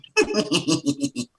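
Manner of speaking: evil-brained cartoon snicker; sinister high-pitched giggle; dark-side Morph laugh. Shown as a demonstration. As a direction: snicker like a character turned evil. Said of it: "it kind of went down".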